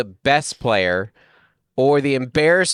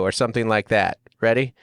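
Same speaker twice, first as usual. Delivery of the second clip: ordinary talk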